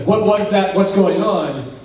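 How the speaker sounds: sharp, loud startled questions; jolted by a sudden bang, demanding answers; alarmed questioning. Retold or imitated. Daryl staring at Kelly after a loud noise, startled and loud. Startled men stare at each other.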